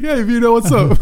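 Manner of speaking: high, breezy pal greeting Vito; chirpy imitation of a guy hailing Vito; mock casual greeting. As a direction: play it like a cheery buddy. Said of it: "He's like".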